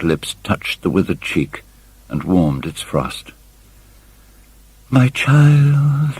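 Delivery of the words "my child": much higher, stagey mother's cry; theatrical cry. Acted. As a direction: play to an imaginary gallery, hammed-up. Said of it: "cried Missus Vane".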